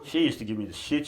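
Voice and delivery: ordinary delivery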